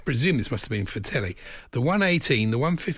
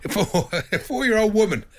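laughing; 10 semitones higher, 4 dB louder than usual. Real, not acted. Laughing at own slip.

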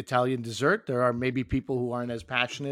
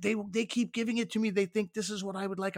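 higher, softer imitation of the daughter; imitating daughter; 6 semitones higher, 5 dB quieter than usual. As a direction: play the teenage daughter, plaintive. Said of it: "She's like".

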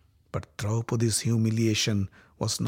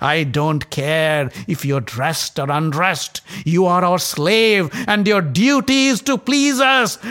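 taunting; 6 semitones higher, 5 dB louder, slower than usual. A narrator's reading. Villain taunts captive.

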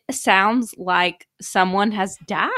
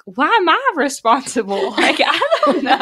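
higher and laughing through the words; laughing disbelief; 6 semitones higher, 4 dB louder than usual. Retold or imitated. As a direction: laugh at your own helplessness. Retold helplessness, laughing.

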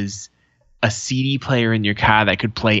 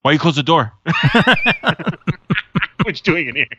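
mock angry dad; 6 semitones higher, faster than usual. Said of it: "busts the door open and he goes".

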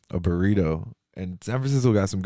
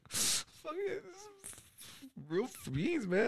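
high, hushed, strangled weepy whimper; mock crying; 13 dB quieter, much slower than usual. Retelling tasting a burrito on tour, mock tearful. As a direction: squeak it out slowly through pretend tears. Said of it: "I start crying".

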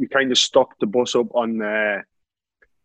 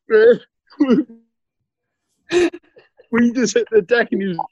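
words squeezed out through real laughter, pitched up; laughing while talking; 9 semitones higher than usual. Real, not acted. Telling his mate the story, laughing hard.

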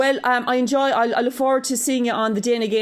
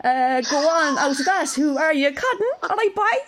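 put-on Cork accent; 4 semitones higher than usual. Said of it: "do it in my Cork accent".

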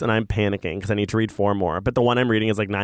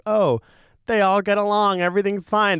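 naive cheerful; 9 semitones higher than usual. Retold or imitated. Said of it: "you're probably like".

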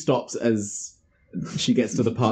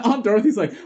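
ordinary talk, nothing marked

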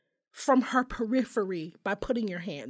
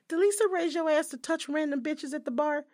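high-pitched comic interrogation; indignant scolding; 6 semitones higher, 3 dB louder than usual. Acted. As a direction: scold him through his mother's name. Acted scolding of the man.

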